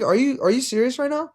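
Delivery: genuinely stunned by the ref; disbelief. Retold or imitated. Coach retells asking the ref again in disbelief.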